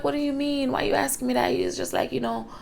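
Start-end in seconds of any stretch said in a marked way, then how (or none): 0.0-1.5 higher, caught-off-guard question; startled mom. Retold or imitated. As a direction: ask it as if the question knocked you sideways.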